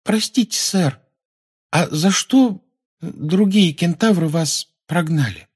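high, hesitant pupil's question; inquiring schoolgirl. Acted. Parvati asking why the centaurs banished him, high and inquiring.

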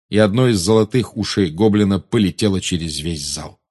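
ordinary delivery, nothing marked

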